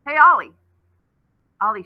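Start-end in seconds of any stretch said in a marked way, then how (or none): 0.1-0.6 calling out. Acted. Coco calls out to Ollie.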